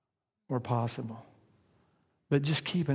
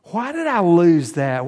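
mock angry why; 4 semitones higher, 8 dB louder than usual. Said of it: "we get angry at God".

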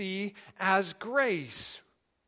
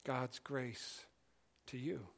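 much softer and lower, unhurried; quiet meditative invitation; hushed and prayerful; 6 semitones lower, 15 dB quieter than usual. Acted. Leading a quiet breathing moment.

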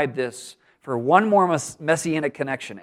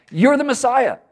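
excited crowd cry; 10 semitones higher, 3 dB louder than usual. Retold or imitated. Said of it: "People are saying".

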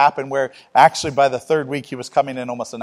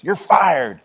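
imitating Russell; 5 semitones higher, 4 dB louder than usual. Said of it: "he goes".

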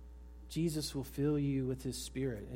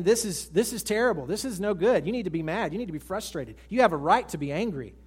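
a touch of an indignant inner voice, louder; inner grumbling voice; 4 semitones higher, 6 dB louder than usual. Acted. Preacher voicing the inner voice that stirs up anger. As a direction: let the nagging voice egg on anger. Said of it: "that voice is saying".

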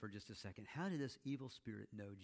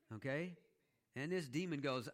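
ordinary delivery

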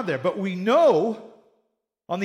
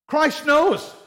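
"Christ knows." said higher and louder; emphatic declaration; 8 semitones higher, 7 dB louder than usual. Real, not acted. Preacher proclaiming to the congregation, emphatic. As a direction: declare it with force.

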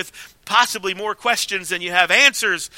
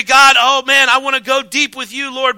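gushing prayer; 4 semitones higher, 5 dB louder than usual. Retold or imitated. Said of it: "We say".

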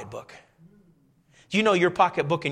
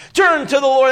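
high loud pulpit cry; hammed-up sermon shout; mock preacher; 10 semitones higher, 10 dB louder than usual. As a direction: call it out like a preacher.

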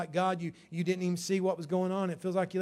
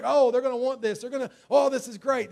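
excited; 6 semitones higher, 7 dB louder than usual. Retold or imitated. Acting his own excitement forwarding deals.

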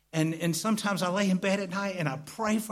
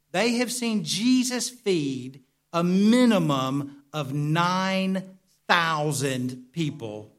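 each word laid out slowly; slow emphatic preaching; slower than usual. Acted. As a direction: drive the point home, slow and deliberate.

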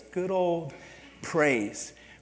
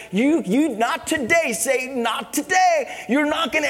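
much higher, much louder defiant shout, slowing on the second "not today"; shouting at Satan; 12 semitones higher, 9 dB louder than usual. Retold or imitated. Preacher defying Satan outside his car, loud defiant shout. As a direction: stand and fight, bellow it. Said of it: "I was so pumped".

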